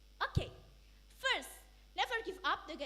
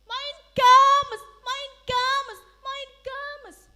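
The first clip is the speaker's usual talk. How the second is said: higher, loud repeated call; excited calling; 7 semitones higher, 15 dB louder, much slower than usual. Acted. Crowd spotting the son's ship, excited calling. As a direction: announce his arrival.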